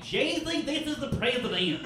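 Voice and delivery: high, hammed-up formal announcement; lightly voiced mock president call; mock mom voicemail. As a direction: parody mom's stiff voicemail. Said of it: "I'm like".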